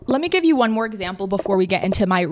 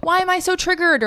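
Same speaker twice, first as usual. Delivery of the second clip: much higher, exasperated self-question; imitating her confused past self; bewildered question; 11 semitones higher than usual. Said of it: "I would have just been like".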